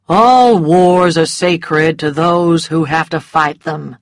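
slow, lazy drawl; bored and lazy. A narrator's reading. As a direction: sound utterly uninterested. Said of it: "in a bored and lazy voice".

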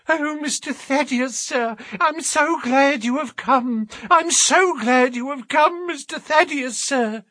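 put-on high, overjoyed old woman's voice; joyful old housekeeper. A narrator's reading. Housekeeper greeting her master, high and overjoyed. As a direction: gush with relief at his arrival. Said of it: "her reiterated rejoicings".

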